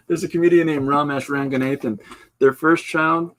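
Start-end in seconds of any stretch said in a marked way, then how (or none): none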